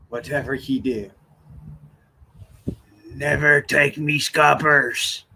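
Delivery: lightly voiced growly-free hammed pirate. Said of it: "that's not how pirates actually talk".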